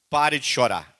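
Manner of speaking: loud, firm title line; preacher's loud command; emphatic command. Shown as a demonstration. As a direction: announce it like a command. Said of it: "É o título da mensagem".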